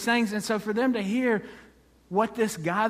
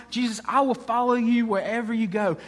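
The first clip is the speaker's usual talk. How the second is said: eager pledge, pitched up; higher, enthusiastic vow; excited follower; 4 semitones higher than usual. Acted. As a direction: declare devotion with excited energy. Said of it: "this person is very excited".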